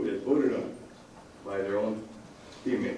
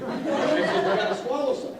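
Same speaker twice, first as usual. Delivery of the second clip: laughing; 14 semitones higher than usual. Real, not acted. Laughing while telling flu advice.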